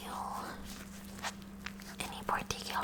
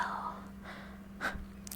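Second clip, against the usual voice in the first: soft resigned exhale; breathy, voiceless sigh; 6 dB quieter than usual. Acted. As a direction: sigh before giving in. Reluctantly deciding to leave.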